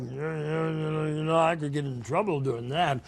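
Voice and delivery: mumbly put-on voice of hesitant misgiving; hammed-up reluctant agreement, stretched out; reluctant Gideon. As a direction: stall and waver, then give in. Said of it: "Gideon was like".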